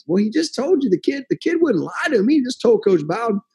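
high-pitched retold pleading; defensive protest. Recruiter answers yelling colleague, retold defensive protest.

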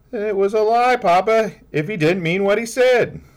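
put-on high, loud child's voice arguing eagerly; a small girl insisting to her papa; child pleading with her father, rushing her words; earnest little girl. Child Bessie's final verdict.

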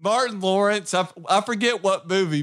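ordinary talk

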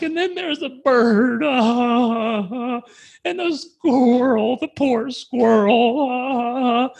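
high, slow, weepy pity for a squirrel; playfully overdone sorrow over small creatures; mock teary sadness. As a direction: ham up being moved to tears, drawn out and high. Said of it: "You're crying over squirrels?".

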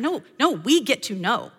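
normal talking voice

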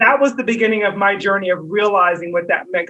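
ordinary talk

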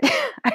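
a high burst of real laughter; quick high laugh. Real, not acted. Laughing at her own story.

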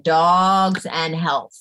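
slow, deliberate words; spelled-out search query. Retold or imitated. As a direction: say it slowly, as if entering a search. Voices typing an internet search.

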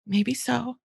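soft, unbothered "Could be"; calm empathy. Shown as a demonstration. Mother answering a whining child calmly. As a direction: let it slide off you calmly. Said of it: "my little phrase".

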